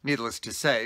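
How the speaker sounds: ordinary talk, nothing marked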